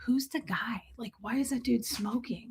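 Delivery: unvoiced whisper under her breath. Shown as a demonstration. Whispering the complaint the way she should have. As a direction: mutter it so nobody hears. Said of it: "it would have been one thing if".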